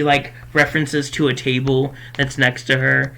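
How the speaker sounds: ordinary delivery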